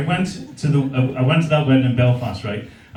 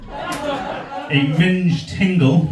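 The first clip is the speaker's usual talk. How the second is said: slow cheeky drawl; 3 semitones higher, much slower than usual. Acted. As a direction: drag out the crude words slowly. Cheeky crude joke, drawn out.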